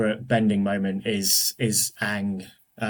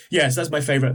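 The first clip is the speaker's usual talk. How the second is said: ordinary talk, nothing marked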